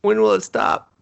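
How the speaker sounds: high, hammed-up wail; mock desperation. Onlooker begging the flips to end, mock desperate. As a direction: plead in mock despair. Mock plea in flip skit.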